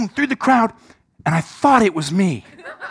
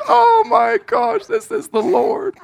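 high, slow mock shriek of delight; wife's laughing exclamation. Wife busting out at her husband's role, high and laughing. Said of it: "She's just like".

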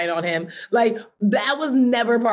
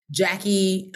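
ordinary delivery

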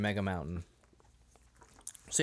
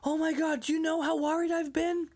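high, fretting mother voice; worried mother; 12 semitones higher than usual. Retold or imitated. Dave fretting at the boys like a worried mother. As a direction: fuss like a frantic mom. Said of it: "freaking out like a worried mother".

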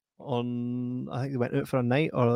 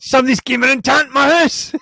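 mock outrage; 12 semitones higher, 12 dB louder than usual. Mock burgled homeowner.

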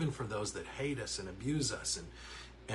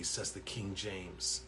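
normal talking voice

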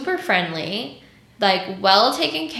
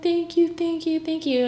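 mock tearful thanks; 9 semitones higher than usual. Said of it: "the lady was just fucking crying".

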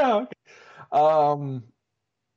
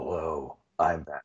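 much lower and softer, a gravelly-free low Batman growl; 10 semitones lower, 7 dB quieter than usual. Pretend. Co-host showing the Batman voice for a laugh, low. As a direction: drop way down low for a quick Batman. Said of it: "talk like Batman".

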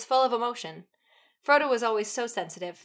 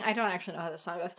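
usual speaking voice

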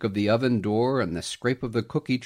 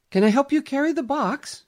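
mother; 5 dB louder than usual. A narrator's reading. Mother's voice in story.

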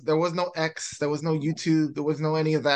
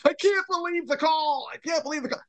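much higher, whiny announcer voice; mock announcer; 12 semitones higher than usual. Mocking Collinsworth who would not celebrate, high whine. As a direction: moan it like a sore broadcaster. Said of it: "where he's like".

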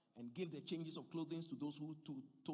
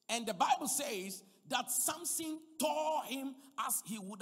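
full-force preaching; 6 semitones higher, 9 dB louder than usual. Real, not acted. Preacher declaring the Spirit came on Samson, full-force preaching. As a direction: proclaim it loudly, stretching key words.

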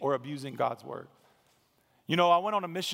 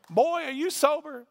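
much higher, scoffing question; incredulous preacher; 9 semitones higher, 3 dB louder than usual. Retold or imitated. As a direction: sneer at the tall stranger in disbelief. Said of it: "he goes".

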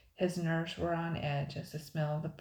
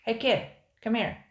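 lightly voiced pirate yell, a touch louder; 6 semitones higher, 8 dB louder than usual. A narrator's reading. A second pirate yelling across the camp to the kid. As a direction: call the kid over from another fire. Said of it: "yelled another pirate".

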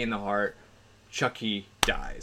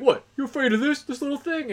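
higher, mocking voice for the cocky detective; mocking taunt; 3 dB louder than usual. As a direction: sneer at him like he's a scaredy-cat. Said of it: "He's like".

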